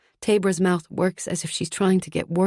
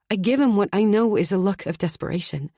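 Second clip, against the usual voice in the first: usual speaking voice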